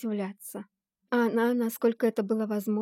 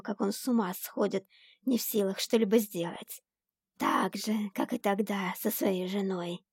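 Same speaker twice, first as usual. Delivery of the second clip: hissing demon. A narrator's reading. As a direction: savour every cruel word. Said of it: "шипело создание тьмы". (шипящий демон)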